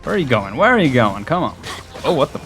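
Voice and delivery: usual speaking voice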